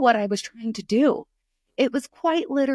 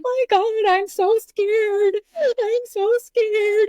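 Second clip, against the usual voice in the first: high, drawn-out mock wail; imitated frightened daughter; 13 semitones higher, 4 dB louder, much slower than usual. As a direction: wail it slowly like a scared child. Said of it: "she was like".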